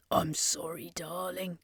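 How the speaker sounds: soft near-whisper, fading away; a tender whispered sorry; softly. A narrator's reading. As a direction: hush the apology gently. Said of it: "Carlene said softly".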